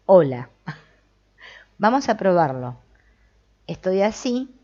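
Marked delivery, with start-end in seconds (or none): 0.1-0.5 inner voice demo. Shown as a demonstration. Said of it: "le digo a la voz que diga".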